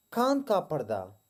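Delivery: ordinary delivery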